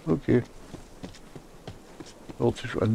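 ordinary talk

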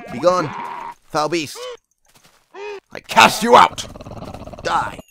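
hammed-up exorcism cry; mock exorcist. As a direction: banish a monster theatrically. Mock exorcism of game monsters.

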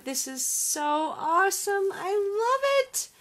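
rising to a high delighted squeal; thrilled gushing. Real, not acted. Crafter thanks friend for gift, thrilled gushing.

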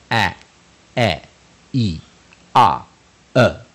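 short A E I O U sounds, each separate; short vowels. Shown as a demonstration. Teacher sounding out short vowels for learners, crisp phonics demo. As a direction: sound out five short vowels, pausing between. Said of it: "短母音就是".

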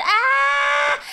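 high sharp practice shout; vocal practice yell. Acted. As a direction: belt a high test note. Practising the growl.

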